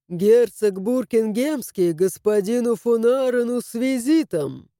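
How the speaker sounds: solemn, proclaiming herald tone; grand entrance announcement. A narrator's reading. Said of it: "торжественно провозгласил он".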